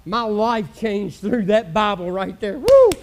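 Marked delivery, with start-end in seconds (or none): 2.6-2.9 a sudden high, loud woo of joy; joyful shout. Real, not acted. Preacher feeling the Spirit of the Lord, shouting woo with joy. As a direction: let the Spirit burst out in one cry.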